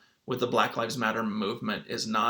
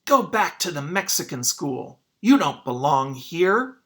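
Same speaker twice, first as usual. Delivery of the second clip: louder, jeering schoolboy shout; yelled taunt; 4 semitones higher, 8 dB louder than usual. Acted. Boy yelling at Sylvia in the hall, loud taunt. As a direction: hurl it at her like a mean kid. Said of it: "pointed at her and yelled".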